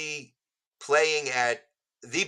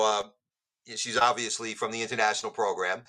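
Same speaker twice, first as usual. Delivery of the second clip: ordinary talk, nothing marked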